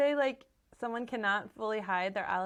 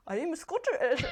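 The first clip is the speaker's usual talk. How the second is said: higher-pitched, badly faked Scottish accent; horrible Scottish accent; 7 semitones higher than usual. Host imitating actress on talk show, bad Scottish accent. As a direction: have a clumsy go at a Scottish accent. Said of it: "that was horrible".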